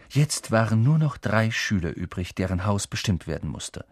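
ordinary delivery, nothing marked

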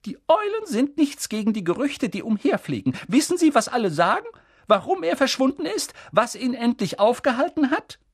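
high, insistent professor voice; McGonagall, urgent. Acted. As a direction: press him with excited, high-pitched questions. Narrator voices McGonagall pressing for answers. (hohe, drängende Professorinnenstimme)

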